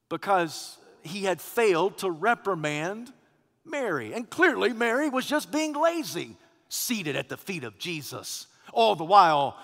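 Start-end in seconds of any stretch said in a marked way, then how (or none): none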